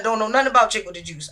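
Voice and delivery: usual speaking voice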